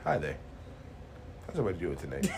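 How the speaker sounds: low, sultry radio host. Pretend. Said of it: "Here's the sultry voice of".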